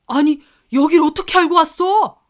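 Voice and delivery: shocked outburst. Retold or imitated. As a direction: blurt it out in panic. Father bursts in, shocked.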